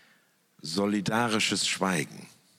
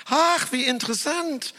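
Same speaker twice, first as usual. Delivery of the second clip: gushing, pitched far higher and louder; a gushing society hostess, all delight; beaming hostess; 5 dB louder than usual. Retold or imitated. Glamorous hostess gushing at her TV guest, much higher. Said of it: "strahlte mich die ... Gastgeberin".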